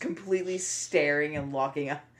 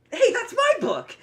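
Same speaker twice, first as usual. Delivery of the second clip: loud, high-pitched shout of realization; indignant outburst; 10 dB louder than usual. Acted. Vin spotting that it's the stolen book, indignant shout. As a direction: burst out loudly, pitch leaping up.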